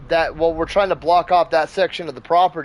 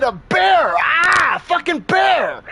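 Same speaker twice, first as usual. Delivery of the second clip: high, loud yelling, hammering each burst; exasperated shouted warning; 12 semitones higher, 4 dB louder, slower than usual. Retold or imitated. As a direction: re-enact screaming a warning across the yard. Repeats warning at top voice.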